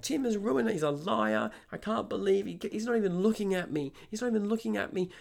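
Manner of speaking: high-pitched imitation of an agitated customer; really agitated. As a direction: mimic her frantic accusing. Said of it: "she's getting really agitated now".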